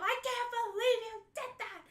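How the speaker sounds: high, loud indignant telling-off; shocked scolding. Retold or imitated. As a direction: scold him in disbelief. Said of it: "she turned and she goes".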